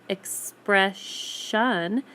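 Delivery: "expression" slowly, in separated pieces ex-pre-shun; "expression" stretched, leaning on the sh ending; broken into syllables. Shown as a demonstration. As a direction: sound out "expression" with a full final shun. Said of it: "makes the ending sound a sh".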